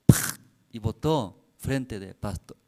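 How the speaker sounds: ordinary talk, nothing marked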